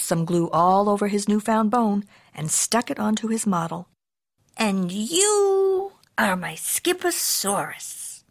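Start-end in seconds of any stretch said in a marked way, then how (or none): 4.6-8.2 high, slow, drawn-out declaration; proud kitty boy. A narrator's reading. Kitty boy naming his bone model, grandly out loud. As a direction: stretch it out high with pride. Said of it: "he added out loud".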